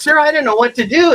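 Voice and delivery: mock a clerk at a loss; stumped clerk. Stumped clerk asking for help.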